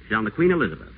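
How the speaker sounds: ordinary talk, nothing marked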